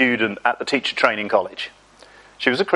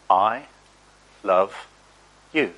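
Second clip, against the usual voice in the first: word by word, with long pauses; solemn, tender declaration; 4 semitones lower, slower than usual. Real, not acted. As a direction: let each word land gently, low and unhurried. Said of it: "I'm gonna say the words".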